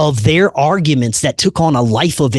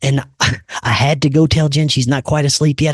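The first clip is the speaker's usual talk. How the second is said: normal talking voice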